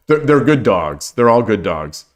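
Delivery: ordinary talk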